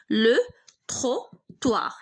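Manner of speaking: slow لو تروتوار with gaps between syllables; halting pace, as if writing each syllable; broken-up dictation. Shown as a demonstration. Dictates the word while writing.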